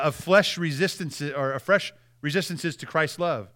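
ordinary talk, nothing marked

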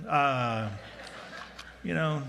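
ordinary talk, nothing marked

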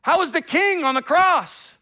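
loud, high-pitched jeering crowd; mocking. Crowd making fun of Jesus on the cross, acted jeering. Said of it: "meant to make fun of him".

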